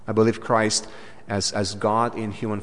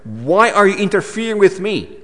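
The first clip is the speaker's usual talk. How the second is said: higher, louder outraged demon; indignant demon; 8 semitones higher, 5 dB louder than usual. Retold or imitated. Preacher voicing the demon's outburst at Jesus. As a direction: spit it out like a cornered spirit. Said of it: "has this phrase there".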